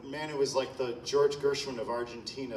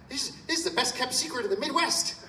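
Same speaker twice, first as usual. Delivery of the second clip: put-on light Minnesota accent, pitched higher and quicker; a gentle imitation of a Minnesota accent, higher and brisk; Libby's Minnesota accent; 10 semitones higher, faster than usual. Conductor quoting the composer's praise to the audience, in a light Minnesota accent. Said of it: "in that Minnesota accent".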